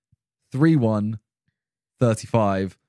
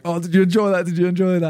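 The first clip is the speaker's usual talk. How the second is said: higher, goading retold questions; mock taunting; 8 semitones higher, faster than usual. Filming losing fans to tease them, mock taunting. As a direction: needle them with a raised, teasing pitch.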